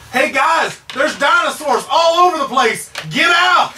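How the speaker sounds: high, urgent warning shout; in-character yell. Acted. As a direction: yell a warning across the park. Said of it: "And I'm gonna yell".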